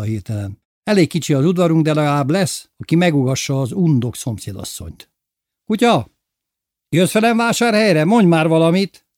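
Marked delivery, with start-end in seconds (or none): 5.6-9.1 high, loud call to the dog; cheerful shout to the dog in the trees. Acted. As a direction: call the dog over, playfully. Calling the dog from afar.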